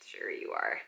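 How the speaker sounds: whispered dry sarcasm; sarcastic whisper. Retold or imitated. Casting director mocking the actress, whispered sarcasm. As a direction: breathe it out, unconvinced. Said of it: "they're like".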